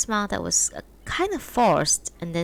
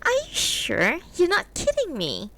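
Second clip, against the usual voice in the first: a hint of a higher woman's voice, teasing; lightly voiced wife; 11 semitones higher than usual. A narrator's reading. Wife teasing her husband about his new friend, lightly voiced. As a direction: voice the wife a little higher, mildly mocking.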